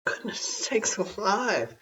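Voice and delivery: breathy, slow whispered gasp; a hushed startled whisper; whispered exclamation. A narrator's reading. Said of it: "said Tugumai".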